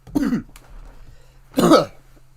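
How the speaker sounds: loud coughing fit; hacking cough; harsh cough. Real, not acted. Coughing fit while eating.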